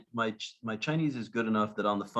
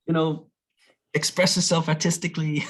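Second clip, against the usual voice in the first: lightly voiced gentle friend, raised pitch; Mike Tyson; 7 semitones higher than usual. Telling the host about his daughter's school, lightly imitated higher voice. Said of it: "He's like".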